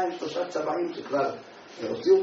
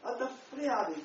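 pushing away; 10 semitones higher than usual. Retold or imitated. Weeping man pushes helper away.

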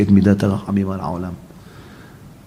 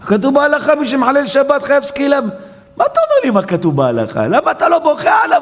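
heated rebuke; 14 semitones higher than usual. Acted. Elijah rebukes the rabbi.

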